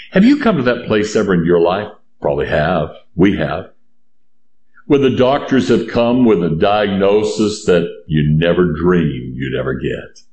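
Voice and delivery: normal talking voice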